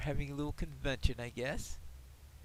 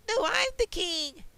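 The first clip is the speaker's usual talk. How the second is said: much higher, louder rival king's retort; 14 semitones higher, 4 dB louder than usual. Acted. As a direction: snap back as the second claimant to the throne. They're having their battle for the throne.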